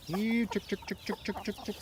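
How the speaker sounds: high quick chick-chick call; calling chickens. Real, not acted. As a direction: call the chicks over to feed.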